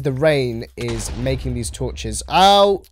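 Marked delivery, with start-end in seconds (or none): 2.3-2.8 loud, high "ow"; sudden cry at being hit; pained yelp. Real, not acted. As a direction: yelp out as if just struck. Hurt in game as mobs spawn.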